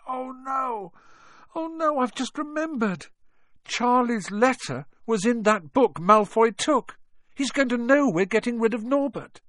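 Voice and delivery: put-on high, panicked boy voice; sudden dread, voice jumps up. Ron panics remembering the letter.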